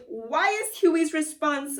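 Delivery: ordinary delivery, nothing marked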